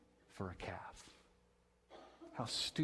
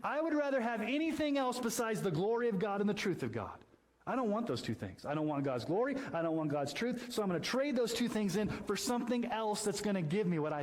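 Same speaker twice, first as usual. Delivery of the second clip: inner voice of the idolater; 9 semitones higher than usual. Retold or imitated. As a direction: speak as the heart that wants anything but God. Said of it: "you're saying".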